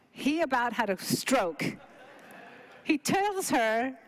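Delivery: ordinary talk, nothing marked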